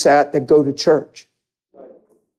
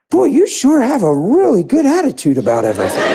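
higher, laughing policeman line; amused policeman; 7 semitones higher than usual. Retold or imitated. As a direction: retell the cop's wry remark chuckling. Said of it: "And he said".

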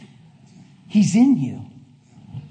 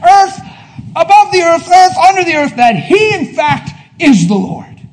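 loud, high rising proclamation; full-force preaching cry; preaching shout; 9 semitones higher, 6 dB louder than usual. Real, not acted. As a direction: ring it out to the congregation. Preaching climax.

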